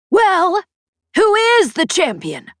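high, loud put-on surprise; theatrical surprise. A narrator's reading. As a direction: feign surprise for the audience. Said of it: "something theatrical about the exchange".

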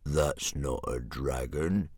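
low, slow dragon jeer; mean, heavy sneer from a brute dragon; jeering gronkle. A narrator's reading. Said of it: "jeered Sea Slug".

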